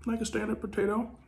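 talking with food in mouth; muffled through mid-chew bite; chewing while talking. Real, not acted. Seen 1: Lips closed, jaw working over potato tray after bite; clears by 4.4.

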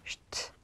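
슈트 as a very soft, vowelless "sht"; SHT cluster. Shown as a demonstration. Teacher sums up initial ST, whispered sht. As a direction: whisper 슈트 with SH before T. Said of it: "스트 볼까요? 슈트".

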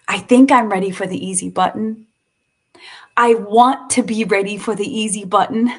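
frustrated outburst. Real, not acted. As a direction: let the frustration out as you say it. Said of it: "match the tone of your voice with the feeling".

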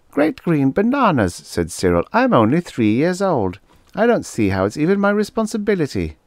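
put-on very high, quick little-boy voice; a tiny boy protesting innocence; toddler. Three-year-old to the officer, very high and indignant.

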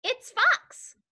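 high-pitched storybook mouse. Acted. Mouse tells the Gruffalo who is coming, high mouse voice. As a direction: pipe up as a little mouse. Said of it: "such an impressive mouth voice".

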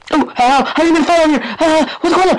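high, startled squawk of alarm; panicked. Real, not acted. Gamer alarmed at a fall in the game, panicked. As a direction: blurt it out high in sudden panic.

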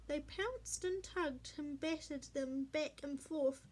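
plain reading voice

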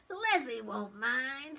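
wheedling little voice; coaxing pigtail. A narrator's reading. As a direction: reassure him slyly, drawing it out. Pigtail coaxes Paul.

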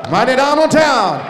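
high, shouted ring name callout; arena announcer. Acted. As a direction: belt it out to the whole arena. Ring announcer calls fighter's name.

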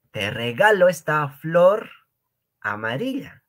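high, loud gift line; playful romantic offer; mock romantic. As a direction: offer a flower, hammed up.

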